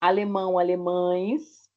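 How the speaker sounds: alemães said slowly with a clear ães; careful teacher example; slow demo. Shown as a demonstration. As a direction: stretch out the ães ending. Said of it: "O pão vai ser pães".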